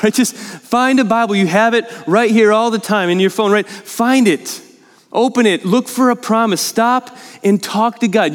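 impassioned preaching, pitched high; emphatic, emotional. Real, not acted. Preacher urges congregation to talk to God, emphatic and emotional. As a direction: urge it on them with rising fervour. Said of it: "all that I just said so emphatically and emotionally".

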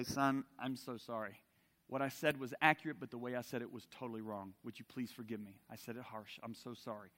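low, soft re-enacted apology; a father humbly asking his son's forgiveness; quietly apologize, voice dropped; gentle apology. Father's apology to his son, acted.

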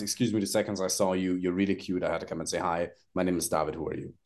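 low, soft, calm opener; demo opener, calm. Shown as a demonstration. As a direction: demo the easy daytime line to a woman, low and calm. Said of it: "the easiest one is".

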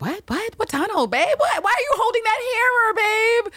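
high, rising, hammed-up panic; mock panicked Cal. Cal panics as she holds a hammer, squeaking in mock fear.

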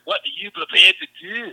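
high, loud movie-cop impression; Sean Connery as Malone. Shown as a demonstration. Quoting the Irish cop from Untouchables, in a loud mock Scottish brogue. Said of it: "he plays the Irish cop, you know, Malone".

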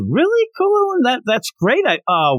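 very high, squeaky surprise; surprised delight. Retold or imitated. Old master surprised by Krillin's rock, squeaky high. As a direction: jump way up in pitch, amazed.

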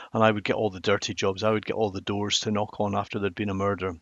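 normal talking voice

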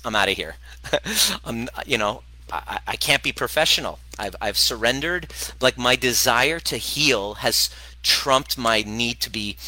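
normal talking voice

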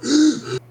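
high winded gasp for air; sharp gasp. Retold or imitated. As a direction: gasp as if the wind was knocked out. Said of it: "you're laying there going".

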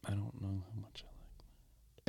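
soft breathy aside; whispering. Real, not acted. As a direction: whisper it like a secret. Aside, unvoiced.

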